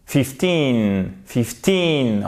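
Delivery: put-on "fifteen" with TEEN long and falling; stress on second syllable. Shown as a demonstration. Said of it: "à chaque fois sur la seconde syllabe". (accent sur la seconde syllabe de « fifteen »)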